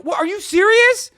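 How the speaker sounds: high, loud, disbelieving cry; incredulous outburst. Retold or imitated. Reacting to the radio booking a guest, loud high disbelief. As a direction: blurt it out in sheer disbelief. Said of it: "I'm like".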